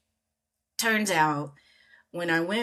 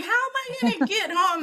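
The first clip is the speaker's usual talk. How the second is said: high, fast worried squeak; panicked question; faster than usual. Retold or imitated. Stranded foreigner asking her hosts, mock panicked high question. Stranded after vice principal left.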